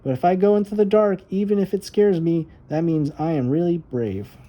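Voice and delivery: put-on high, eager child's voice; little girl voice. Little Violet telling her brother about the dark, high and earnest. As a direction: play the small sister talking herself brave.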